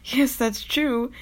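ordinary talk, nothing marked

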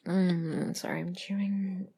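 normal talking voice

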